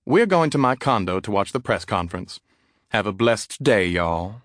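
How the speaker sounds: cheery Southern farewell; sweet Southern "blessed day" send-off; southern drawl. Acted. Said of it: "southern drawl".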